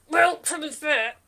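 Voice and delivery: loud muffled attempt at talking; mouth gagged. Gagged bandit tries to chime in, muffled. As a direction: talk through a mouthful of sacking. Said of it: "spits the burlap sack on the ground".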